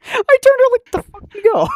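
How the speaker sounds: high, yelling where-did-you-go; loud shout. Real, not acted. Vlogger shouting at a co-host who vanished, loud and high. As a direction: bellow it out, startled.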